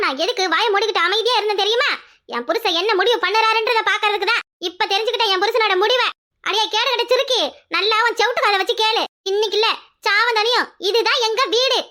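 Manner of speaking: high-pitched, furious declaration; angry defiance. Acted. Woman refusing to leave the family house, angry and defiant. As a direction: stand your ground and lay down the law.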